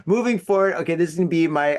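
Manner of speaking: ordinary delivery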